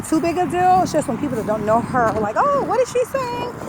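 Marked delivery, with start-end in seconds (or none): 2.4-3.5 high puzzled stranger voice. Retold or imitated. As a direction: act out a confused listener.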